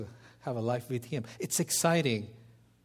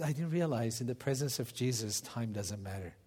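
softer, lower, wondering; hushed awe; 4 semitones lower, 7 dB quieter than usual. Retold or imitated. Husband telling wife of Jesus' presence, hushed. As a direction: let it sink in quietly. Awed quiet reflection.

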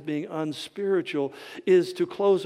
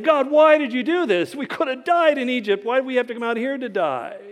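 desperate plea; 6 semitones higher than usual. Acted. Moses crying out to God.

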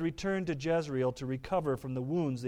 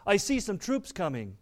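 lookout's report called out mildly, slightly higher; calling out, mildly; 5 semitones higher than usual. A narrator's reading. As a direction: read a sighting as a light call. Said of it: "he called out".

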